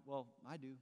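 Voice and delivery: soft, low-pitched interjection; understated, knowing reply, dropped well down; quiet aside. Retold or imitated. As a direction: answer softly from the back of the room. Jesus quietly answering from back.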